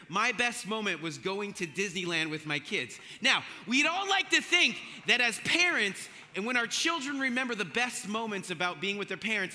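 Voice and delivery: ordinary talk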